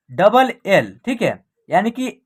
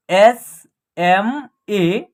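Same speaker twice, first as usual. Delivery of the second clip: "एस ए डी" letter by letter, slow; spelled out slowly; much slower than usual. Shown as a demonstration. Teacher spells the example letter by letter, slowly. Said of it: "small".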